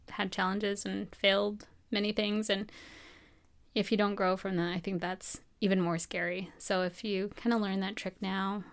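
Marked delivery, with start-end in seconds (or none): none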